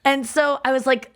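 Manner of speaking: ordinary delivery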